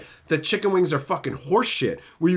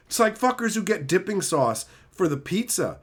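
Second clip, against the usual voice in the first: ordinary talk, nothing marked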